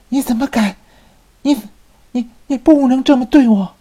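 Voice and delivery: high, loud stammering rage; furious roar. A narrator's reading. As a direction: shriek at him in fury. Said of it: "米莱迪怒吼道".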